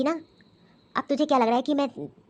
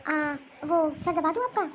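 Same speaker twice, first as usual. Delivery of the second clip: timid daughter-in-law; 3 semitones higher than usual. Acted. Daughter-in-law caught leaving, timid offer to mother-in-law. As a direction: caught out, hesitant young wife.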